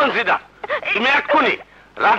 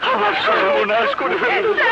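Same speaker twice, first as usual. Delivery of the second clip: fake distress; 9 semitones higher than usual. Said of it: "sir, কেমন অভিনয়?".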